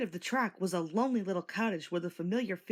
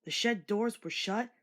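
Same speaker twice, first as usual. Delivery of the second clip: ordinary delivery, nothing marked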